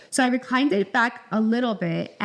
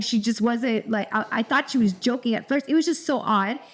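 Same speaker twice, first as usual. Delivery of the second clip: ordinary talk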